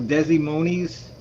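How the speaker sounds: "Des Moines" said as "DEZ-ee MOH-neez"; slow, unsure "Des Moines" as "dezzy-moneys"; mispronounced Des Moines. Real, not acted. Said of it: "Or how do you pronounce that?".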